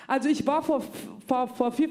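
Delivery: ordinary talk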